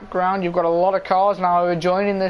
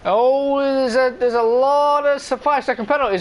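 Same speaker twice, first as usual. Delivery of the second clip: excited; 6 semitones higher, 3 dB louder than usual. Real, not acted. Sudden excited spot mid-race.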